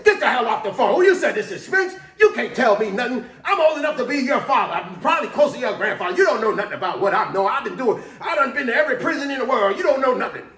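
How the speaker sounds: loud, high shouting tirade; an old driver shouting down a helpful coworker; furious old man yelling. Retold or imitated. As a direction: put the young guy in his place. Jerry explodes at Spinks.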